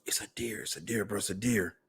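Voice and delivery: soft, urgent hunting murmur, imitated; hushed alert. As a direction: murmur it so the deer won't hear. Brother signalling quietly in the blind.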